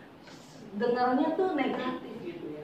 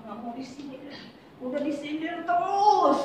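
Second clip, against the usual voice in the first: mock fed-up complaint, pitched higher; 5 semitones higher than usual. Congregant tired of being needled by an elder, fed-up complaint. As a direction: imitate someone worn down by digs. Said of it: "gitu katanya".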